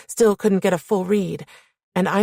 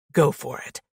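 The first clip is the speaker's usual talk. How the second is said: slightly lowered voice of a menacing man; deep male voice; 3 semitones lower than usual. A narrator's reading. Man opening his arms to her, lightly deepened dare. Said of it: "deep, menacing".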